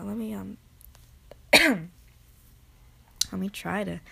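ordinary talk

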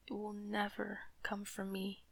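ordinary talk

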